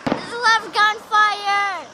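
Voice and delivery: loud, drawn-out excited call; excited shout. Real, not acted. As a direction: call it out, thrilled. Excited at fireworks.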